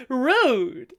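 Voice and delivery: high, loud mock-hurt cry; playfully wounded high yelp; indignant yelp. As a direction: wail it in mock offence. Play-crying over rude treatment.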